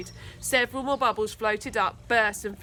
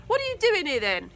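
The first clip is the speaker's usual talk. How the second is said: higher, pushy bully demand; 7 semitones higher, 4 dB louder than usual. A narrator's reading. Charlie questioning the kids, higher demand. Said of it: "he demanded".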